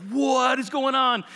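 high, drawn-out astonishment; shocked disbelief. Acted. Pharisees seeing Jesus enter the tax collector's house, shocked. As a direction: stretch it out in high-pitched shock.

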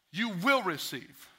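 slow, hitting "will" hard; stress on will. Shown as a demonstration. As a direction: underline "will" with your voice, slowly. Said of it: "Underline the word will".

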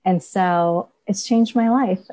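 usual speaking voice

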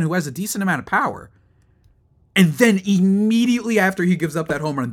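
exasperated rant. Real, not acted. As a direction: fume at the umpires, voice climbing high.

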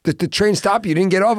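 loud, high incredulous questioning; mock interrogation from a stunned uncle; shocked disbelief. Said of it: "he was shocked".

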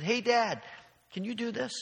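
put-on children calling to their dad, a light eager child voice. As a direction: call up to dad like an excited kid.

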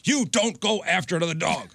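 high imitation of a scolding wife; wife scolding dog. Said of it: "and my wife's like".

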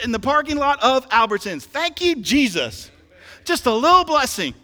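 loud, urgent exhorting preacher; full-force preaching. Real, not acted. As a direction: fire up the congregation with loud conviction.

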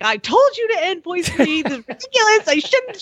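half-laughing retold tirade; mock scolding. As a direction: vent at the friend. Scolding friend on phone.

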